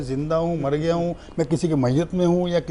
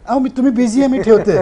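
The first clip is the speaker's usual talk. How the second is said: principal hanging up; 7 semitones higher, 8 dB louder than usual. Retold or imitated. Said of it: "तुम्ही काय म्हणतात".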